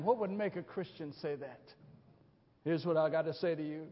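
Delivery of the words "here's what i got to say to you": ordinary talk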